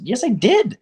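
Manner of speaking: loud, high angry retort; heated shout. Retold or imitated. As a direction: snap back loudly and insist. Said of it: "I start firing back".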